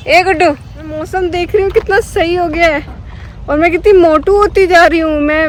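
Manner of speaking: high, playful complaint about getting fat; playful self-teasing. Real, not acted. Vlogger jokingly complains to her dog about weight, playful high voice. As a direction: tease yourself in a high sing-song.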